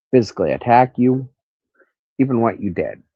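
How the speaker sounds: ordinary talk, nothing marked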